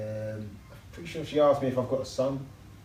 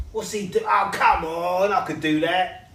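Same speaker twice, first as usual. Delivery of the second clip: scoffing at the telly; 6 semitones higher, 3 dB louder, slower than usual. Retold or imitated. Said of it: "I watch the telly and I think".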